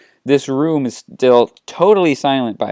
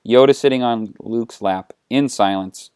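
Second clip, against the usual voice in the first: usual speaking voice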